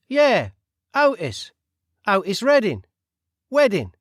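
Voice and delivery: nosy Cockney stranger butting in; loud, cheeky Cockney voice; Cockney accent. Acted. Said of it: "said the Cockney man".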